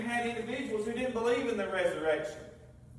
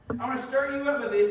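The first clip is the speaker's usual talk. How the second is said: louder preaching; 4 semitones higher, 6 dB louder, faster than usual. Acted. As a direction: repeat it louder and faster, driving. Preacher repeats line, builds.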